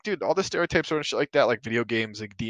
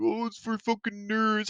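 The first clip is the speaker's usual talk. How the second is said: high, slow sneering mock voice; mocking nerd taunt; 10 semitones higher than usual. Mimicking critics who call players nerds, high and sneering. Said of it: "people are like".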